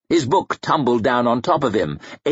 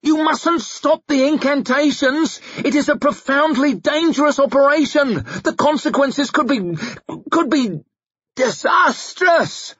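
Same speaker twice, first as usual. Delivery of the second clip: shrieked. A narrator's reading. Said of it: "shrieked Candelao".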